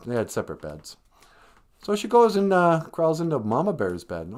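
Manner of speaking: ordinary talk, nothing marked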